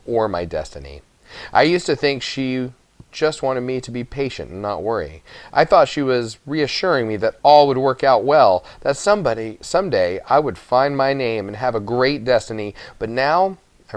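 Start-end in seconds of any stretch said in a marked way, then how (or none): none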